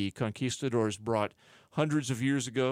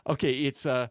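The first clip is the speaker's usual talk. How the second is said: usual speaking voice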